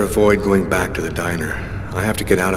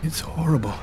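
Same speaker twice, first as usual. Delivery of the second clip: shaken, breathless whisper; 6 semitones higher, 6 dB quieter than usual. Acted. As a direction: whisper it in horror. Panicked man after finding a body.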